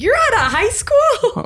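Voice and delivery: mock shocked squeal; incredulous. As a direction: shriek it in disbelief. Incredulous quoted reaction.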